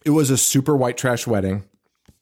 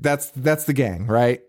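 ordinary talk, nothing marked

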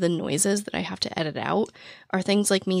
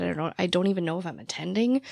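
ordinary delivery

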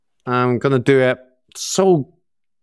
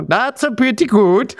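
hammed-up mock Norwegian, higher; Norwegian accent; 6 semitones higher than usual. Host can't stop imitating Norwegians, higher mock Norwegian accent. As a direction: exaggerate a bouncy Norwegian lilt. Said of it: "ugly, uh, imitation there".

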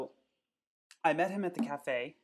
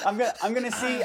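laughing; 7 semitones higher, 8 dB louder, faster than usual. Real, not acted. Laughing through speech.

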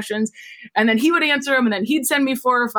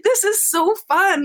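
gushing delight; 9 semitones higher, slower than usual. Retold or imitated. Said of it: "the whole time I'm like".

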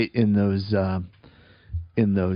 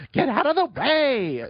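high, drawn-out mock yell; retold yell; 14 semitones higher, slower than usual. Woman at the dog park yelling at someone leaving, high mock yell. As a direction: imitate her yelling, pitched way up and stretched out. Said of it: "she, like, yelled at me".

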